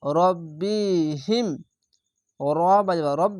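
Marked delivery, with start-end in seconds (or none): 0.0-1.5 রব্বিহিম drawn out with a doubled ব; slow recitation. Shown as a demonstration. Teacher modelling the reading, slow recitation. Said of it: "teacher demonstrates recitation".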